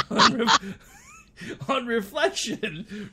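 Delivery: slow, laughing through the words; can barely read it for laughing. Real, not acted. Laughing while reading quote.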